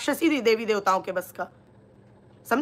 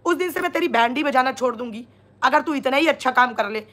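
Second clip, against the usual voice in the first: ordinary talk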